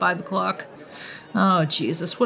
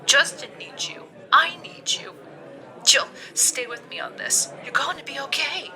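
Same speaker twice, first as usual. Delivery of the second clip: pleading friend; 9 semitones higher, 6 dB quieter than usual. Acted. Friend pleading, comforting Jill.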